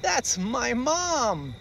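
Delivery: slow high delighted voice; baby bear amused; giggly cub. Acted. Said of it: "Baby Bear giggled and said".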